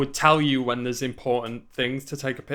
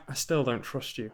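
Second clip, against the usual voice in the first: much softer, guarded quote; Saeed; 2 semitones lower, 10 dB quieter than usual. Retold or imitated. Saeed still doubting the newcomer, quietly distrustful. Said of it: "he's also like".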